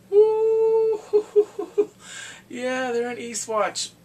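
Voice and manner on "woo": high loud whoop into laughter; whoop, then break up laughing; excited whoop. Real, not acted. Whoop and laugh at new scene.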